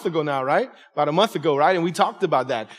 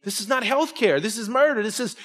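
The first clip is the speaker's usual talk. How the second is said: riled-up outburst; 5 semitones higher, 3 dB quieter than usual. Retold or imitated. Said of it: "I'll get riled up and be like".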